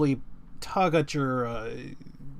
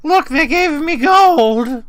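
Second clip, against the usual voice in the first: high, loud nervous creature voice; squeaky kobold protesting; 11 semitones higher, 11 dB louder than usual. Acted. Kobold pleading with the party.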